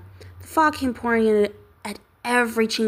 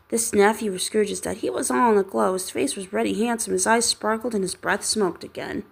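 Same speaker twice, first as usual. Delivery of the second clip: usual speaking voice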